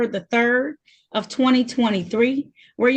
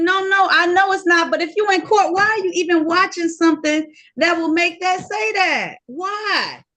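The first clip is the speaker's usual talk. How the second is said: raised, higher scolding voice; exasperated scolding; 7 semitones higher, 4 dB louder than usual. Real, not acted. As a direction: scold him for the noise in court. Judge scolds defendant's video noise.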